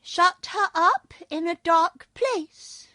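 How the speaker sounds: high, slow toy bear voice; a small wind-up bear, slow and high; little pink bear. A narrator's reading. Pink bear answering the king, high and slow. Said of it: "answered the little pink bear".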